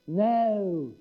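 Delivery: "No" in a Southern drawl, drawn out. Shown as a demonstration. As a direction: drawl "No" like the locals. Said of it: "saying the word no in a funny way".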